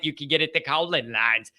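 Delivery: ordinary delivery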